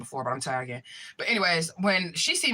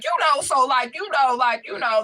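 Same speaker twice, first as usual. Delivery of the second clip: raised, loud, repetitive filler talk; backing-him-off talk; 8 semitones higher, 5 dB louder than usual. Retold or imitated. She talks at man standing too close, backing-him-off talk. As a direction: re-enact talking big to make someone step back. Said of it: "I started talking like this".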